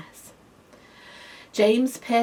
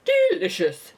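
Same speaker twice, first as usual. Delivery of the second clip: louder, lordly verdict; grandly decreeing; 9 dB louder than usual. A narrator's reading. Grasshopper praising the peach, grand loud decree. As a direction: pronounce it like a judge. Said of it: "decreed the grasshopper".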